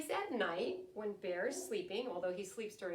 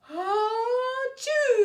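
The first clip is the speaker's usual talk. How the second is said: big hammed-up achoo, much higher and louder; huge sneeze; 15 dB louder than usual. Bear sneezing awake in his den, huge hammed-up achoo. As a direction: blast out a giant bear sneeze. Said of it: "He blows and he sneezes".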